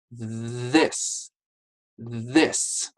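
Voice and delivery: "This" with the tongue out between the teeth; exaggerated th practice. Shown as a demonstration. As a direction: stretch the th of "This". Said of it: "we should say this".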